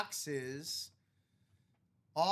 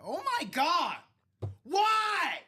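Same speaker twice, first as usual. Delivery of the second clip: shocked shout; 13 semitones higher, 7 dB louder, much slower than usual. Real, not acted. Dice roll shock.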